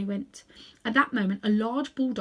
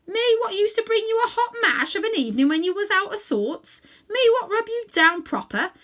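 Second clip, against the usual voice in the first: Cockney cabbie; 9 semitones higher, 6 dB louder than usual. Acted. Cabbie hurt, reproachful.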